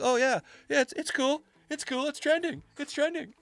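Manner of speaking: high, mocking sing-song voice; mocking a gullible person. To his friend, mocking people who accept decline, in a high mocking voice. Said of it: "and you're like".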